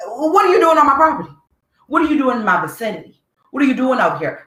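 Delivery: high, rapid-fire mock challenge; acted outraged questioning; indignant confrontation. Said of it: "I need you to feel me when I say this".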